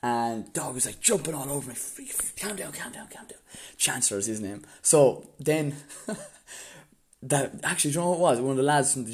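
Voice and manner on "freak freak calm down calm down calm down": whispered hush. Retold or imitated. Calming the jumping dog in a hiding whisper.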